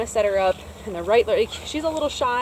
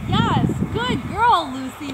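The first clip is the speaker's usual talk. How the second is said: higher, louder, stretched-out praise; excited praise; 7 semitones higher, 6 dB louder, slower than usual. Real, not acted. Trainer praising Lucy as she comes in, excited and stretched out. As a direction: cheer her on as she arrives. Praising dog after recall.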